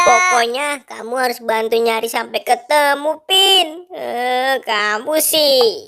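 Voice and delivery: ordinary delivery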